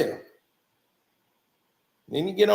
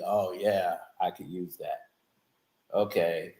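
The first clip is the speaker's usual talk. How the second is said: much lower, softer lazy [name] imitation; mock [name]; 9 dB quieter than usual. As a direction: mumble it low like a bored star. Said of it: "[name] be".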